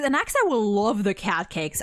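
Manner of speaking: ordinary talk, nothing marked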